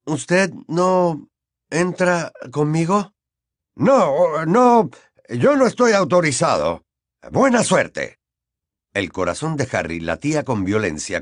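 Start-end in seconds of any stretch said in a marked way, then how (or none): 0.1-3.1 timid. Acted. Harry nervous question. 3.7-8.2 regretful Mr Weasley. Acted. Weasley sends him off.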